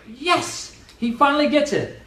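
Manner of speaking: high, delighted exclamation; mock triumphant relief; relieved cheer. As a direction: cheer like he finally got it. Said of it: "And you're like".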